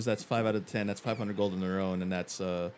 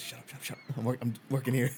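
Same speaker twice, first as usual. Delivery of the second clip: much softer, hurried under-the-voice aside; acted hushing; 6 dB quieter than usual. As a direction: hush your companion on the sly. Hushing a companion during a pitch.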